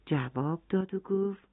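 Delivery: ordinary delivery, nothing marked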